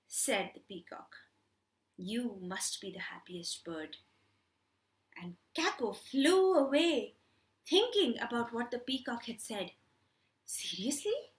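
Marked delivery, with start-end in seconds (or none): 2.0-4.0 hushed whisper to the crow; soft whispered peacock line. Acted. Peacock's secret told softly. 10.5-11.3 whisper. Acted. Crow doubting to himself.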